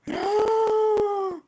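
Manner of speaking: sharp high gasp of delight; big excited intake of breath; surprised gasp. Real, not acted. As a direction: gasp loudly at the photo. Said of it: "Oh my God".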